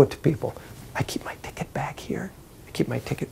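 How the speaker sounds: whispered, hushed and confidential; secret whisper. Acted. Good guy hides ticket humbly.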